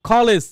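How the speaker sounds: কলেজ opening on a breathy kh, "kholej"; aspirated initial k. Shown as a demonstration. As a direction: puff air on the first k of কলেজ. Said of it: "C এর আগে কিন্তু কোনো S নাই".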